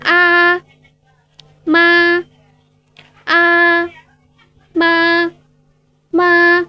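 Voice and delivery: "ma" held high, level and steady; high level first tone. Shown as a demonstration. Mandarin teacher demonstrates the first tone on "ma", high and level. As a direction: sing "ma" out on one high flat note. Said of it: "Suaranya harus stabil, eh, tinggi".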